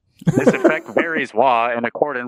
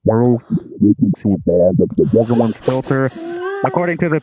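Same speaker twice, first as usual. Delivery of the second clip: talking through wah pedal; 3 semitones lower, faster than usual. Shown as a demonstration. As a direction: voice run through a resonance filter pedal. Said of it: "Audio resonance is what I'm on right now".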